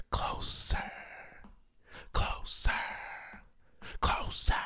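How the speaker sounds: hushed, voiceless repeating whisper; whispered echo. Pretend.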